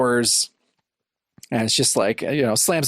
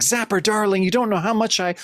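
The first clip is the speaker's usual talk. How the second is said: higher, lightly voiced heroine gushing then caught out; swooning Jen; 6 semitones higher than usual. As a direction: imitate Jen's breathless welcome, pitched up. Jen opens door, voiced in retelling.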